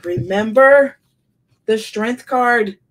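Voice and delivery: real excitement on a sudden realization; excited realization. Real, not acted. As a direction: burst out with the sudden insight. Sudden realization during reading.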